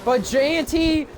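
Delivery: high, excited race call; motorsport commentator. Acted. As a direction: call the overtakes at full energy. Live race play-by-play.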